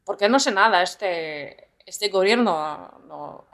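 put-on native-sounding Spanish accent, hesitant este. Joking with her co-host about the government in a Spanish accent. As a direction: fill the gap like a Spanish speaker. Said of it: "It's uncanny how you sound Spanish".

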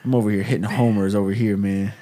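ordinary talk

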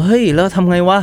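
retold outburst. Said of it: "เราก็โวยวายว่า". (โวยวายแบบเล่าย้อน เสียงสูงขึ้น)

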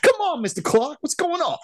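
high-pitched pleading imitation. Student pleading with Mister Clark, high squeaky voice.

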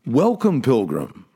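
ordinary talk

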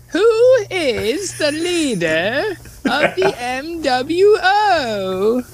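high, slow, drawn-out proclamation; grand announcer voice. Shown as a demonstration. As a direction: stretch it out like a showman. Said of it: "Hold on, hold on".

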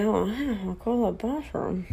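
a small hushed joke under her breath; soft, lightly voiced aside. To her boyfriend on the run, a soft mock aside. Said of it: "And I'm like".

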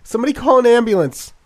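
frantic high yell for help. Acted. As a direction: scream it out in panic. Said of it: "a woman yelled".

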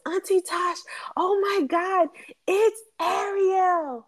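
put-on high, slow, awestruck child voice; little cousin's excited voice. Aunt retelling her little cousin seeing Ariel, high awestruck child. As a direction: gasp it out like a wide-eyed little boy. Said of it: "And she came out and he goes".

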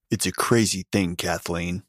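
plain reading voice

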